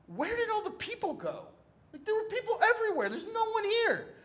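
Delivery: high-pitched baffled disbelief; bewildered retold outcry. Wounded man finds the street empty, retold high bewilderment. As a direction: ask it high, incredulous at the emptiness.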